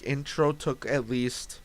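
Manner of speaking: ordinary delivery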